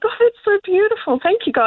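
high, thrilled little exclamation; delighted gasp. Retold or imitated. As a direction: gush with wonder at the rainbow. Retold delight at rainbow.

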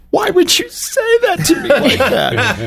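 very high, wailing hammed-up hurt; high, teary, exaggerated mock offense; mock hurt wife. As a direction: wail it like a wounded spouse. Mocking spouse's hurt reaction.

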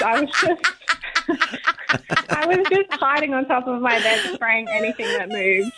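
helpless giggles breaking into the words; laughing. Real, not acted. Caller recalling spraying spiders from the bed, laughing hard. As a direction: tell it through real laughter.

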